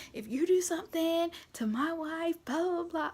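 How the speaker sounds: high mock threat; freaked-out warning. Husband sent out warning the medical staff, mock threatening. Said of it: "my husband was freaked out about that".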